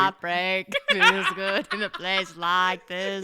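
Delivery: put-on slow Boston Kennedy accent, half laughing; Boston-accented presidential read; JFK impression. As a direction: give it the full Kennedy speech cadence. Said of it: "You've got J F K down".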